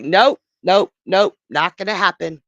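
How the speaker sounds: loud, firm nope after nope; loud refusal. Acted. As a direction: shut it down hard like a protective mother. Nephele refuses, mother protecting son.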